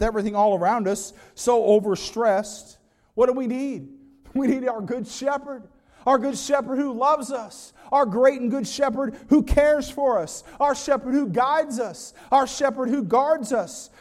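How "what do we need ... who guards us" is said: higher-pitched, rhythmic pulpit refrain; impassioned preaching. Real, not acted. Preacher assuring the flock of their shepherd, fervent and rhythmic. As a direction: lift each repeated phrase like a rallying refrain.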